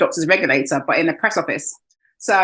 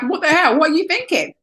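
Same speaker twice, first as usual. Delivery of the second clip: higher, incredulous retort; exasperated disbelief; 10 semitones higher than usual. Retold or imitated. Her inner reply to the caller, exasperated. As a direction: throw the question back in disbelief. Voicing her own outrage.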